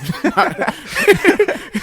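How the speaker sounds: real laugh, loud and high; a high, loud cackle at the story; loud high burst of laughter. Real, not acted. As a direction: break into a big, loud laugh. Laughing at her own story.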